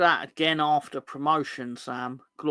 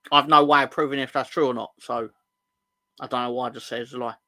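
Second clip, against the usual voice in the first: ordinary talk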